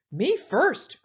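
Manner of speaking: an eager pig running and crying out; cried out. A narrator's reading. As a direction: shout it as you race ahead. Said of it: "cried Pinkerton".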